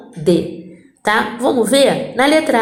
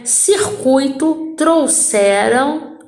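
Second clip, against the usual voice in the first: slow syllable reading; 3 semitones higher, much slower than usual. Shown as a demonstration. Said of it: "tro, tro".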